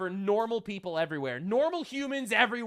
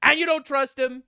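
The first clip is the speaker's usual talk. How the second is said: loud, high, incredulous; excited; 7 semitones higher, 9 dB louder than usual. Real, not acted. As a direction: blurt it out, delighted. Co-host laughing, incredulous.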